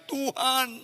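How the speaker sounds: rising, pleading cry to God, partly shouted; anguished prayer. Retold or imitated. Preacher relives crying out to God for forgiveness, anguished. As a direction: beg God for mercy, voice climbing.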